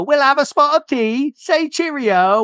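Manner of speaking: high, hammed-up posh British accent, slow on cheerio; mock British accent. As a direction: play a silly British tourist. Mock Brits in London.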